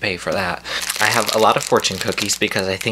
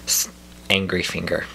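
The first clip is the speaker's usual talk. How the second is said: ordinary delivery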